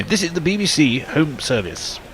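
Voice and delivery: vintage BBC Home Service newsreader voice; old BBC announcer. Retold or imitated. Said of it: "sort of the sound of the".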